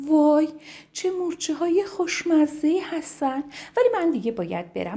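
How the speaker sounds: high, sheepish bird voice; high woodpecker admitting she forgot. Acted. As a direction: own up cheekily, mouth full. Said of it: "خورد و گفت".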